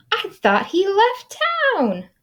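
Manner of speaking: very high squeaky voice; high little critter voice. Acted. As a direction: squeak it in surprise. Other prairie dog.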